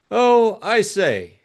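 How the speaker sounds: drawn-out astonished oh; elderly father aghast at his daughter's plan. Acted.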